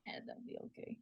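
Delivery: breathy whispered reply; soft unvoiced agreement. Real, not acted. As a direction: say it under your breath. Whispered aside.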